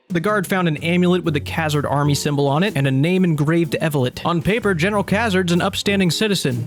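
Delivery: ordinary talk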